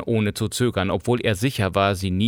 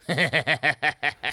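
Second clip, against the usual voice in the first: short, spiteful higher-pitched laugh; nasty laugh; 5 semitones higher than usual. A narrator's reading. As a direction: laugh at the trick with malice. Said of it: "lachte garstig". (gehässiges Lachen)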